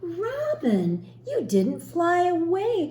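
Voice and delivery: light up at seeing Robin again; exclaiming. Acted. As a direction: Hare delightedly calls out to Robin, surprised she stayed. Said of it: "exclaimed Hare".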